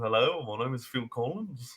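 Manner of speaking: put-on soft Liverpool-accented greeting. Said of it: "Liverpoolian accent".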